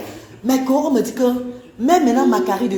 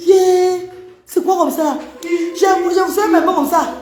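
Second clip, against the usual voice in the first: surprised; 8 semitones higher, 3 dB louder than usual. Retold or imitated. Retold shock at marks.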